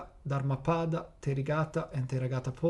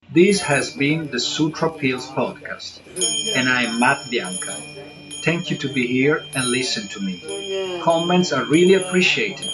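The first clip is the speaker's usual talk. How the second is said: podcast outro; 8 dB louder than usual. Acted. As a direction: close the show warmly, loud and clear. Podcast sign-off and blessing.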